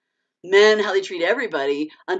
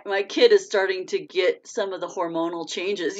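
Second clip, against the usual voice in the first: normal talking voice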